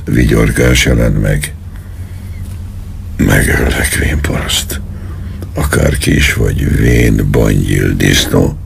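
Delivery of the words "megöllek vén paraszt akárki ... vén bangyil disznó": low, menacing growl of a threat. Acted. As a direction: threaten him in a lowered, strained voice. Colonel threatens with a pistol. (fenyegető, mély hangú szitkozódás)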